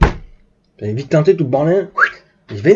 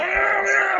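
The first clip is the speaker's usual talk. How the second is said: drawn-out high engine wail; imitated engine roar; 13 semitones higher, 4 dB quieter than usual. Storyteller shows them flooring it, high imitated engine roar. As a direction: mimic the car tearing off. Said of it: "Ils enquillent comme ça".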